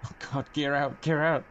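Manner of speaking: urgent, frantic plea; terrified gibbering. Real, not acted. Panicking while landing the plane.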